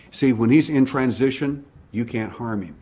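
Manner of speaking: usual speaking voice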